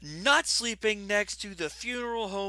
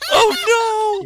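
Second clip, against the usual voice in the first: high, loud drawn-out "oh no"; loud cry of dismay; 14 semitones higher, 13 dB louder than usual. Real, not acted. Player shouts at where the tent scene is heading, loud dismay. Dreading what comes next in game.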